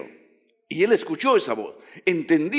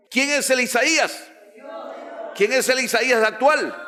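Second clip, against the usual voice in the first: fiery preaching; 9 semitones higher than usual. Real, not acted. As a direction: demand an answer from the pews. Preacher calls out question.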